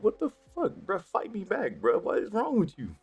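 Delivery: high, incredulous what the fuck; baffled he won't swing back; his inner voice demanding the guy fight back; exasperated disbelief. Retold or imitated.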